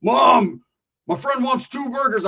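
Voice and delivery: high, loud call, slow at the end; calling out to mom. Retold or imitated. Friend calling to his mom about burgers. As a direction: shout the order into the kitchen.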